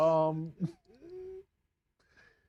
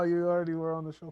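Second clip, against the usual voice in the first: ordinary talk, nothing marked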